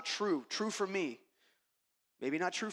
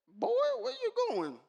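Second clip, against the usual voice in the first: much higher dad's voice calling out. Retold or imitated. Dad calling after his son, much higher. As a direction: call after the boy as his father. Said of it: "my dad's like".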